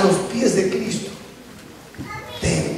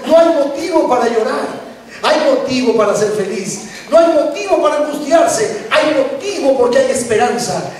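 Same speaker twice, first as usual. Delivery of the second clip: rising, ringing proclamation; impassioned preaching; 4 semitones higher than usual. Acted. Preacher proclaiming Jesus' words of hope, impassioned. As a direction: build to a high, triumphant cry.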